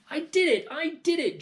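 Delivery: put-on high, loud boy insisting; defiant shout. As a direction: shout it up at her. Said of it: "George shouted".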